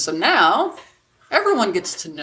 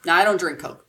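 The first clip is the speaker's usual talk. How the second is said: ordinary delivery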